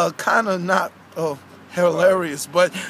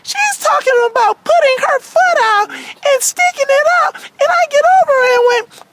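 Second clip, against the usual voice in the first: earnest puppet character, higher than the host; character storytelling voice; aggrieved Wendy; 4 dB louder than usual. Acted. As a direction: recount it as a wronged cartoon girl. Wendy tells of being tripped.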